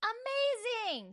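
high, slow, drawn-out amazing; excited. Real, not acted. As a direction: gush over it. Defending Nicolas Cage's movie.

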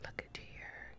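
tender hushed aside; whisper. Real, not acted. Looking at baby Evelyn, whispered.

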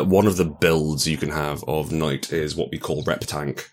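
ordinary talk

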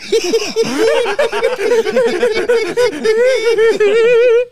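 squealing laughter, nearly crying; high helpless laugh. Real, not acted. Cracks up at failed attempt.

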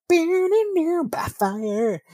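sung high, held note; a mock operatic cry of doom; high sustained sing-song on "By fire"; dramatic echo. Echoing the death sentence.

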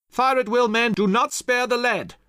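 high, loud rallying order; shouted command. A narrator's reading. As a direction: shout to your troops to open up. Hamilton commands musketmen in battle.

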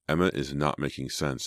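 ordinary delivery

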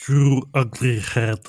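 slow, loud gargled alien voice; drawn-out, throaty creature impression; Drac. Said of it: "exactly what the Drac sounds like".

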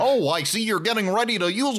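high, eager gurgly toilet; lightly voiced leering toilet; creepy toilet voice. Voicing the gargling toilet.

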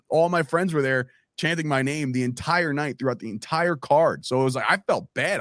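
ordinary talk, nothing marked

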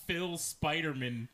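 Spider-Man as "SPY-der-mun"; surname pronunciation. Retold or imitated. Tim quotes the Friends joke, Spider-Man ending in weak "mun". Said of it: "It's not a last name".

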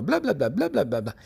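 lightly mimicked nonstop jabbering; mock chatter. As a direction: babble like warts that never shut up. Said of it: "Son parlanchines y nunca dejan de hablar".

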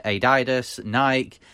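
usual speaking voice

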